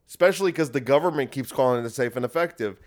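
ordinary delivery

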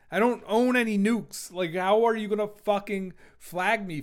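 exasperated. Real, not acted. Exasperated rant about flagging.

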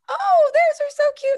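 very high, loud cooing gush; gushing delight. Retold or imitated. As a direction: gush over the cute socks like a thrilled old lady. Birthday lady opens the socks.

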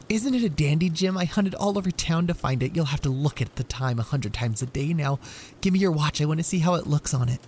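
high, loud and excited; bursting with eagerness; eager Della. Acted. Wife eagerly showing husband his gift, high and loud.

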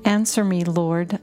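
ordinary talk

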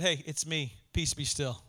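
low, soft, gentle command; understated calm, just speaking to the storm; calm, unyelling Jesus. Retold or imitated. Jesus quieting the storm without yelling, calmly spoken. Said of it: "Didn't yell it. He just spoke to it".

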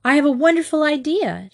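high, eager burst of enthusiasm; excited. A narrator's reading. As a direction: announce it eagerly, pitched right up. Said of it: "Now she jumped up".

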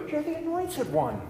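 a soft, high Peter in awe; Peter's awed voice. Acted. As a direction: breathe it as a stunned disciple. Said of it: "is said by Peter".

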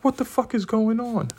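high-pitched panicked question; high, incredulous mock panic; acted alarm. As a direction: reenact bewildered alarm, pitch shooting up. Acting out his alarm on stopping.

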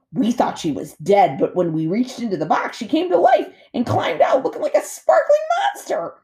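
otter voice climbing to a high squeal; storytelling otter, building. A narrator's reading. Otter telling gosling of his mom waking, building excitement.

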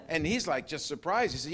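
usual speaking voice

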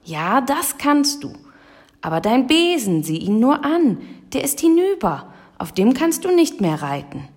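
high, agitated scolding; worked-up raven complaining in high pitch; indignant raven. A narrator's reading. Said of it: "krächzte er aufgebracht".

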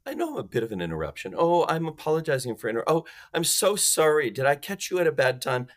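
mock groveling salesperson; over-apologetic. Shown as a demonstration. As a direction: gush apologies like a nervous caller. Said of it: "Not, ... None of that crap".

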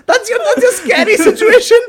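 high, loud, laughing ridicule; mock it while cracking up; laughing mockery. Real, not acted. Mocking the horror movie's scary scene, laughing.

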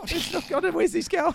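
high, put-on bemused voice. Real, not acted. Co-host lost in the silly app-name brainstorm, bemused. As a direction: toss it up high in mock confusion.

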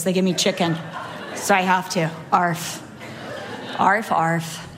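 low, laughing, half-hearted arf; bored dog. Retold or imitated. Preacher voicing the lazy chihuahua, laughing, low. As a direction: bark like you can't be bothered.

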